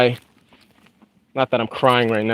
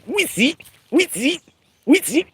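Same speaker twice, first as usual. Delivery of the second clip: much higher repeated chirps, fading in loudness; bird call impression; 13 semitones higher, 4 dB quieter than usual. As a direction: whistle it like a small bird in the twigs. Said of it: "that was a horrible impression".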